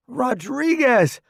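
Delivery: high slow yell over the bombs; shouting. Acted. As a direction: yell names across the noise. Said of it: "I shout over the loud whistles".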